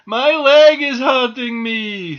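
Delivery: high, loud, drawn-out whine; pained wail. A narrator's reading. Squirrel interrupts doll to nurse, pained wail. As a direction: burst in wailing about the pain.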